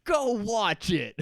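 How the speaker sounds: loud, slow angry snap; mock angry shout, slowed on go watch it. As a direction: bark it at her like a furious Jack. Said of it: "what Jack says in an angry voice is".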